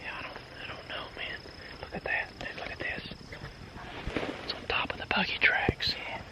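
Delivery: hushed whisper in the woods; hunting whisper. Real, not acted. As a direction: whisper so the birds can't hear.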